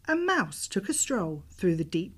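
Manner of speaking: usual speaking voice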